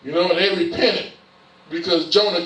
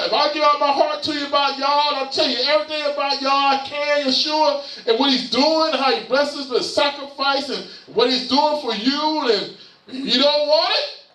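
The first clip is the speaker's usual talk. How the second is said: high-pitched, insistent sermon delivery; impassioned preaching; 7 semitones higher than usual. Real, not acted. Preacher giving his heart out to the congregation, impassioned. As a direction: pour it out in a sustained raised voice.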